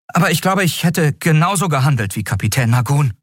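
put-on high-pitched young lad's voice; earnest boy. Boy admitting he knew nothing, high and earnest.

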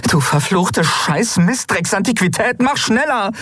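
put-on high, exasperated cursing at the old ship. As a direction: swear at the machine to hurry. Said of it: "fluchte Endriel".

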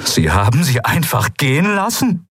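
high-pitched, incredulous challenge; outraged disbelief. Acted. Kai confronts the admiral over letting her go, outraged. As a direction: demand an explanation, voice climbing high.

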